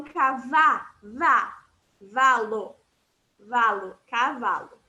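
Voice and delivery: cavalo broken apart slowly, cavá, vá, valo, with a clear V sound; syllable breakdown. Shown as a demonstration. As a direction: stress the V in cavalo, piece by piece. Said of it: "do som de V".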